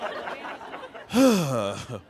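long exaggerated exhale; drawn-out theatrical sigh; insistent sigh. Shown as a demonstration. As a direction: sigh again, fishing for a question. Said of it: "you have to keep sighing".

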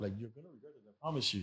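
very soft, under the breath; retold hushed warning. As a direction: warn her privately, barely audible. Retold private warning in the Uber.

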